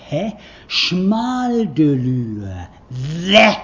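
slow, loud, drawn-out sound poem; sounding out words. Shown as a demonstration. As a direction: declaim the syllables slowly, with sudden cries. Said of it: "Zuerst wieder eine Überschreibung". (langsam und laut deklamierte Lautpoesie)

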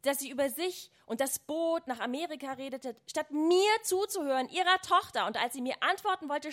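narrated tirade, rising in pitch and volume; angry shouting, read aloud. A narrator's reading. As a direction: build the outrage toward a shout. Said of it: "ich schrie sie an, schrie".

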